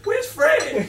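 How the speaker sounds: high, loud comic holler; big guy bellowing. Retold or imitated. Storyteller voices big six nine looking for Fred, loud mock bellow. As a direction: holler it like a huge guy demanding his friend.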